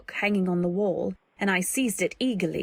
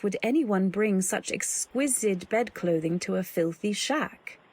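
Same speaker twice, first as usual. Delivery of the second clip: ordinary delivery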